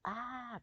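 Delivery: high imitated "Ah" of a startled woman; mock female cry. Describing the coyote mimicking a woman, high mock cry. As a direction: squeal it like a frightened woman. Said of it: "copying some female going".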